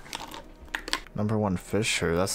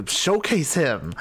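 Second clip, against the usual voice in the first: excited; 7 semitones higher, 4 dB louder, slower than usual. Real, not acted. Excited reading of a message.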